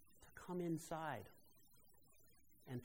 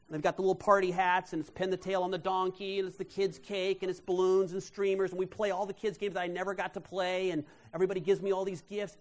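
louder, higher, tumbling out with joy; excited retelling; 4 semitones higher, 11 dB louder than usual. Retold or imitated. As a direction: gush about the party like it's happening now. Retelling the joyful surprise party.

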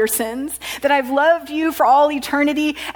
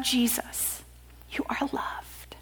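hushed whisper; 11 dB quieter, slower than usual. Acted. Preacher whispering to the congregation that they are loved. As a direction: drop to a soft whisper on the promise. Sermon, intimate message of love.

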